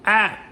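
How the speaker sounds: put-on sudden high, loud baby-like "Ah!" cry; baby cry shout. Shown as a demonstration. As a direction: blurt it out like a crying baby. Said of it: "What sound do babies make?".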